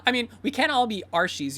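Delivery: loud, high, scoffing reply with a laugh; high, exaggerated agreement; Saya. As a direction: laugh it off loudly and mockingly. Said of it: "Saya says".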